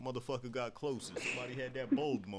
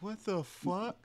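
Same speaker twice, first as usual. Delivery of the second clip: higher, incredulous mock outrage; shocked disbelief; 8 semitones higher, slower than usual. Telling a friend about the meowing dog, higher baffled outrage. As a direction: act baffled at a dog that meows.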